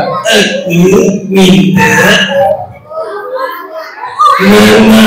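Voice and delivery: halting puppet attempt, word by word; puppet's botched echo.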